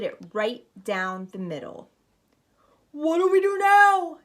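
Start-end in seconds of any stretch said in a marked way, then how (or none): 2.9-4.2 much higher, louder frightened shout; panicked pirate yell. A narrator's reading. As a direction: yell it over the crashing storm. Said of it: "yelled one of the pirates".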